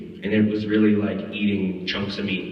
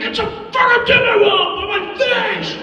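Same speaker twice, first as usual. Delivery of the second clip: very high, loud comic yell; re-enacted shrieking outburst; panicked protest; 7 dB louder than usual. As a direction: scream back at the crew in exasperation. Protests with wolf on face.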